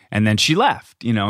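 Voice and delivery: normal talking voice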